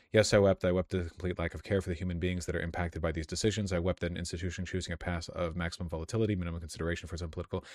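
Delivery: soft, low, hurried read; subdued, skimming read-aloud; reading a statement. Real, not acted. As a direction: rush quietly through the written quote. Reads a quoted statement aloud.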